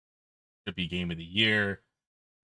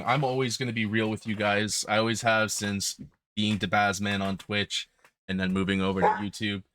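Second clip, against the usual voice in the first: ordinary delivery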